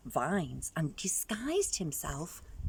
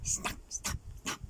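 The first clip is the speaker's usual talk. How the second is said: snapping teeth; 7 dB quieter than usual. Acted.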